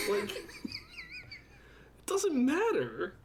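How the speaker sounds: very high, drawn-out comic whine; mock exasperation. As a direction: stretch it out slowly in squeaky protest. Exasperated mock rant at nitpicker.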